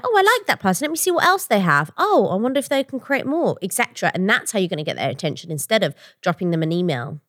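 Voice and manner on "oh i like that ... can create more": higher, musing voice of an intrigued listener; light, high-pitched curious quote; imagined label reaction. Acted. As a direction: play an impressed label scout, pitched up. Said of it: "a label will be like".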